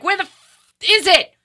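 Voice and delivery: high, indignant where is it; mock exasperation. Host mocking the manifesting gurus, high and indignant. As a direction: demand it like you were cheated.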